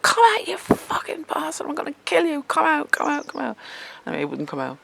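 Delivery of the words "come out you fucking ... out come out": retold screaming. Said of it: "I was screaming".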